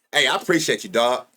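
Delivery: ordinary talk, nothing marked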